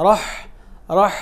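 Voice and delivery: "রহ" with a short vowel, straight into h; quick joined rah. Shown as a demonstration. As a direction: jump quickly from r into h in "রহ". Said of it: "রাটা হার মধ্যে খুব তাড়াতাড়ি আসবে". ("রহ"-এ র থেকে দ্রুত হা-তে যাওয়া)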